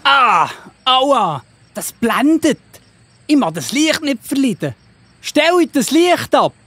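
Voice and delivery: wincing, hurting, slowing down on the plea; blinded man crying out in pain; pained cry at the light. Acted.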